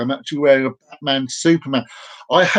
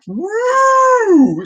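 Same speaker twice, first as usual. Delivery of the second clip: high, long whoop; loud rising "woo" held out; excited whoop; 5 dB louder than usual. Real, not acted. Host cheering to viewers mid-chat, excited whoop.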